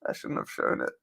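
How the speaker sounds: muttered under breath, in dismay; whispered regret. Real, not acted. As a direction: whisper it in despair. Whispered third take.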